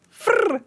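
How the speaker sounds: quick high trilled frr; rolled R trill. Retold or imitated. Streamer imitates the friend's rolled R, gleefully. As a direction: mimic the rolling R.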